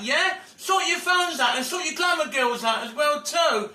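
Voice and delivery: furious complaint, shouting; frustrated rant. Real, not acted. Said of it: "very frustrated if he can't get through".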